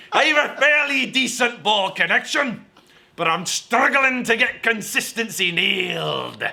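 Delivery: slow, high, hammed-up Scottish accent; Scottish accent slipping into laughter; awful Scottish accent. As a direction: keep dragging out a bad Scottish accent. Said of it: "I'm an awful Sc-, uh, Scottish accent".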